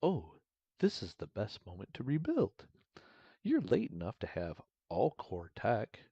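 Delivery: sugary-sweet assistant, swooping pitch. Acted. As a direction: read it as an overly sweet, helpful AI. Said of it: "my, my A I voice".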